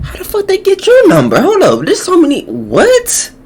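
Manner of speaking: very high-pitched questioning; high, baffled reaction; incredulous outburst. Real, not acted. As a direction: burst in with squeaky disbelief. Interrupts clip in disbelief.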